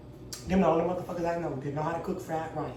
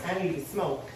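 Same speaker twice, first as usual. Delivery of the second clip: ordinary talk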